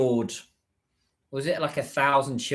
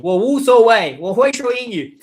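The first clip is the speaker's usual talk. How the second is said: mock reply, much higher and louder; hammed-up eager retort, pitched up and loud; imitating people's reply; 10 semitones higher, 10 dB louder than usual. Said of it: "I have a lot of people say this all the time".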